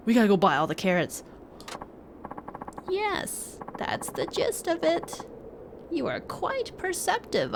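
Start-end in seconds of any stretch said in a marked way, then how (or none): 2.9-7.5 put-on slow, lightly aged old man; a wise old hermit, unhurried. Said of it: "My old man voice".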